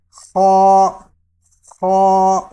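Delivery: خا loud and heavy from the upper throat; heavy kha. Shown as a demonstration. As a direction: push خا out full and heavy. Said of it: "یہ حلق کے اوپر والے حصے سے ادا ہوتا ہے".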